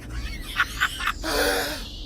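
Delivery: high, breathless shrieking laugh; wild unhinged laugh. Acted. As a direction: cackle out of sheer giddiness. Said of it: "What was that non-word sound".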